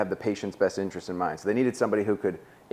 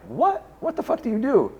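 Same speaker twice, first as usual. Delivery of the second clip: shocked disbelief. Retold or imitated. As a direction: pitch jumps way up in stunned disbelief. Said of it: "And I'm like".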